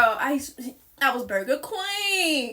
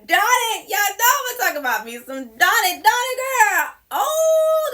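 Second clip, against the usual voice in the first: higher, louder excited gushing; 7 semitones higher, 7 dB louder than usual. Real, not acted. Hyping her favorite herb to viewers, loud and high gushing. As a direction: rave about the herb like a best friend.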